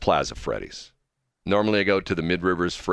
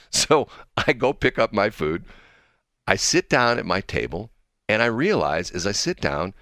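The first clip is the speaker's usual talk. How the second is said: ordinary talk, nothing marked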